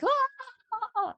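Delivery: imitating husband's sudden high yelp; a short high panicked "Ah!" as the husband sees the water; startled yelp. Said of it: "And he's like".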